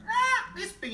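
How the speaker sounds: high, loud squawk; parrot crying out. Acted. Parrot showing how big Big Bro is. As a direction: cry it out wide. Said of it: "he cried".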